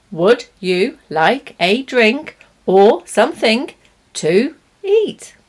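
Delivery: every word clear and separate; overly careful. Shown as a demonstration. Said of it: "I'm saying all the words clearly, stressing them".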